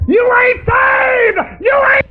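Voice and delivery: high, slow, crying shout; preaching shout. Real, not acted. Preacher shouting at the congregation that they ain't saved, high and crying. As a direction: wail it out at the top of the voice.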